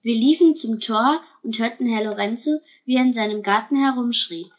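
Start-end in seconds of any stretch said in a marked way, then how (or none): none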